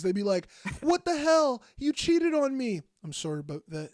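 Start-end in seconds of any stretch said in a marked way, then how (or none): 0.8-2.8 high-pitched, drawn-out mock accusation; intense acted outrage. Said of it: "acting out the most intense scenes". 3.0-3.9 soft, low Canadian apology; meek mock-Canadian reply, dropped right down; Canadian accent. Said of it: "Canadian people say".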